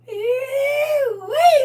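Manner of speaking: high, loud, crying shout of joy; excited squeal. Real, not acted. Scene: loving her favourite movie, letting out a high squeal. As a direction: let out a joyful squeal.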